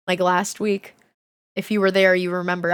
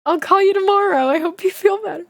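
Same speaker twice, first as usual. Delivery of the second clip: mock sweet goodbye; 8 semitones higher than usual. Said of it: "and I'm like".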